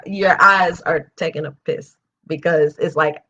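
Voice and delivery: usual speaking voice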